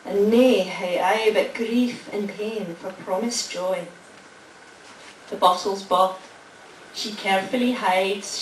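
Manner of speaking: put-on poem read in a lightly Scottish accent; Scots accent reading. Reading the winning Scots poem to the lunchtime crowd, mild Scottish accent. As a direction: recite the Scots verse with a touch of Scottish lilt. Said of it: "without lapsing into a terrible Scottish accent".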